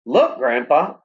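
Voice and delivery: calling out eagerly; excited boy calling out; cried out. A narrator's reading. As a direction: point and call to him. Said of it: "I cried".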